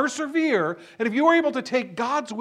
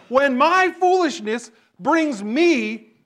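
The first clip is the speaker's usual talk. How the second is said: louder and higher, slowing hard on I blame God; emphatic preaching; 6 semitones higher, 6 dB louder, slower than usual. Real, not acted. Preacher to his congregation, building to a loud emphatic finish. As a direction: press it home like a pulpit climax. Preacher drives home the point.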